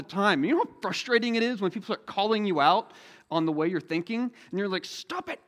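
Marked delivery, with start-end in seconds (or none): 4.8-5.4 high, petulant stop it; mock protest. Student protesting to friends who call out his thinking, high petulant. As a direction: whine at friends who won't let you be. Pushing back on friends calling you out.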